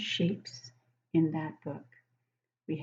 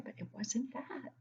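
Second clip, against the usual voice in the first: whisper; 4 semitones higher, 13 dB quieter than usual. Acted. Teasing whisper while rejecting a guess.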